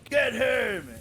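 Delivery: high, loud shout to his pet; calling out a command. Pretend. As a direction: yell the order across the field. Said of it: "I go".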